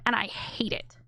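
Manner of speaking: hushed, breathy aside of contempt; whispered disgust. Real, not acted. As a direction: drop to a whisper: and I hate it.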